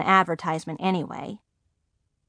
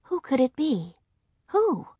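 higher, hushed pondering; wondering to oneself; 6 semitones higher, 4 dB quieter than usual. A narrator's reading. Margaret puzzles over the unopened invitation, hushed wondering. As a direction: muse it softly in a half-whisper. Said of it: "I thought".

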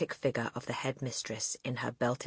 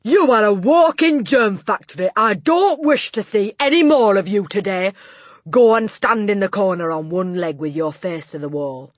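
loud, high tirade; domineering headmistress, outraged; 6 semitones higher, 12 dB louder than usual. A narrator's reading. Headmistress sending the boy to the corner, outraged and loud. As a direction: bellow insults and orders at him.

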